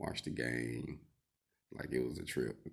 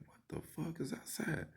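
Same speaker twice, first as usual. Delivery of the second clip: high-pitched mock bafflement; retold startled question; 3 dB quieter than usual. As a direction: voice jumps way up, puzzled, as if retelling it. Retold startled reaction to noise.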